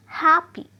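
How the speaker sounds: Happy with HAP loud; stress on first syllable. Shown as a demonstration. Teacher showing adjective stress, loud HAP in happy. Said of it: "first syllable पर trace किया".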